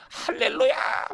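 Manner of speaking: very high, loud hallelujah shout; acted exultant shriek of praise; jubilant caller. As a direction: imitate a church member crying out in joy. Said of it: "그 집사님이".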